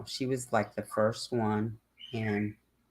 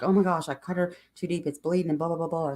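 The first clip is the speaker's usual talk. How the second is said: retold fluster, quick and higher pitched; freaking out; 5 semitones higher, faster than usual. Owner phoning the vet about a bleeding nail, freaking out in retelling. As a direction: rattle it off in mock panic. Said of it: "I was freaking out".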